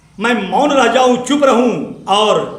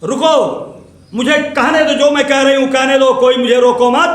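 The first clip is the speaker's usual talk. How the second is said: loud, raised demand to be heard; insistent plea; 3 semitones higher, 6 dB louder than usual. Acted. Speaker telling others not to stop her talking, loud insistence. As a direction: push through as if refusing interruption.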